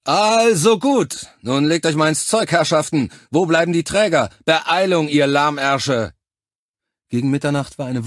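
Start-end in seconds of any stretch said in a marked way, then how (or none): 0.0-6.1 shouted drill orders. A narrator's reading. Officer hurrying soldiers with the carriers, loud impatient shouting. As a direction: bellow to get a lazy crew moving. Officer yelling at work crew.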